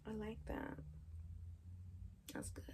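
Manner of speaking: soft, hushed approval, fading out; sultry murmur. Acted. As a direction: purr it quietly to him. Seductive look at partner.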